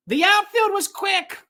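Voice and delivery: ordinary talk, nothing marked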